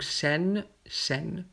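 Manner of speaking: chaîne with aî as open è; open vowel. Shown as a demonstration. Teacher reads the spelling variants alike, open è in chaîne. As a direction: say chaîne as "shèn". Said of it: "ça se prononce A aussi".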